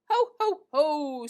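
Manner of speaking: loud, booming ho ho ho; Santa laughing. A narrator's reading. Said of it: "Santa laughed".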